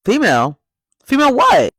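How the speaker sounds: high, loud disbelieving echo; incredulous outrage. Real, not acted. Reacting to Adam calling women females, loud outrage. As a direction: demand he finish the word.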